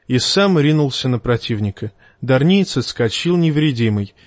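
ordinary delivery

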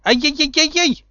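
high, loud squeal of pain; squealing yelp. A narrator's reading. Potter seized by the ear, squealing. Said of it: "заверещал Поттер".

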